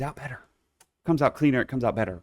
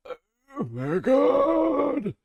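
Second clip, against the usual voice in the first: swooning; much slower than usual. Real, not acted. Swooning over gif.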